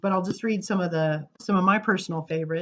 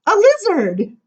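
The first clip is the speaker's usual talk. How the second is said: eager answer; 13 semitones higher, 10 dB louder, slower than usual. Acted. Child in poem answers eagerly.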